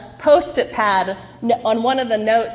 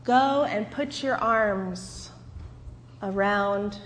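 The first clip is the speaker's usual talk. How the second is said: a hushed voice calling from nowhere; softly voiced God guiding Hagar; quiet divine voice; 2 semitones lower, 8 dB quieter than usual. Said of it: "a voice said to her".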